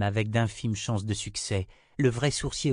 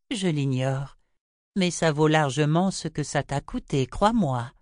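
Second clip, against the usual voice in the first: higher-pitched, self-assured seer; Chota, assured; 6 semitones higher than usual. A narrator's reading. Chota defends her price to Richard, high assured voice. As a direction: insist on it in a raised pitch.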